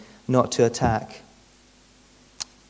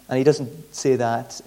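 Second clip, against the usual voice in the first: ordinary talk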